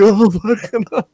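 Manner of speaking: high-pitched panicky gasp of words; shaken exclamation. Retold or imitated. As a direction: act out reeling from a nightmare. Acting himself shaken after nightmare.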